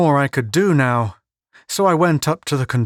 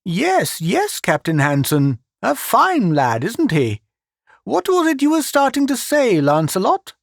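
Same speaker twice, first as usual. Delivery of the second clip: higher, doting voice of a foolish uncle; lightly voiced doting old man; fatuous old man; 9 semitones higher than usual. A narrator's reading. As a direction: beam proudly about the nephew. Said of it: "smiled fatuously".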